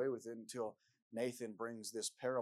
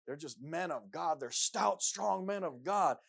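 mock heroic praise; 5 semitones higher, 8 dB louder, slower than usual. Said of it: "We read in the Bible sometimes".

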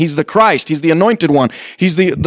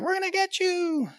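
very high, soft squeaky little threat; tiny ant voice; 11 semitones higher, 14 dB quieter than usual. Acted. Ants threatening the person who found their anthill, tiny and high. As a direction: menace someone huge in a tiny voice. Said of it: "these ants are like".

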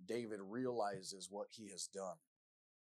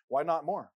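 loud demand; 5 semitones higher, 12 dB louder than usual. Acted. Repeated angry question.

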